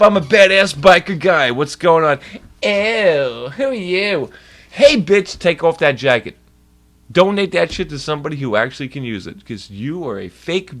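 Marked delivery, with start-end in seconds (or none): 0.0-2.2 loud, hammed-up bragging poser; mock tough guy. Mocking the fake biker. 2.6-4.3 higher, mocking ew; hammed-up grossed-out poser; snooty disgusted girl. 4.7-6.4 loud, higher hammed-up telling-off; shouted mock challenge; mock confrontation. Real biker confronting the poser.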